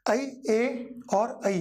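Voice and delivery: ए and ऐ each stretched out very slowly and kept apart; slow vowel demo. Shown as a demonstration. Teacher contrasting ए and ऐ for students, very slow. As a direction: draw out ए and ऐ very slowly so the difference is heard. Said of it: "बात समझ में आती है?".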